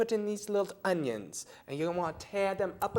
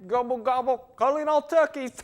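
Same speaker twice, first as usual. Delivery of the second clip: turkey call; 4 semitones higher, 6 dB louder than usual. Acted.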